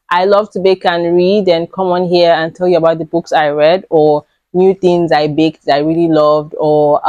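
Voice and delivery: normal talking voice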